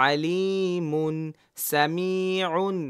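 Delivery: slow, with সামিউন's ain sounded clearly; teacher drilling slow clear Arabic syllables. Shown as a demonstration. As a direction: stretch সামিউন slowly, ain distinct. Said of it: "দুটা আইন যেন স্পষ্ট উচ্চারণ হয়".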